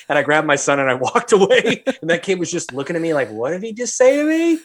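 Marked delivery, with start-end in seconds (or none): 3.3-4.6 put-on high-pitched incredulous child; imitated stunned kid. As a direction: ask it as a baffled little kid.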